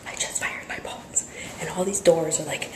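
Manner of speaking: a gasp, then a real whisper. Real, not acted. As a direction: whisper it so the office can't hear. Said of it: "I don't want people to hear".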